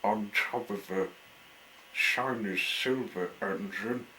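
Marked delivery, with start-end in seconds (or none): none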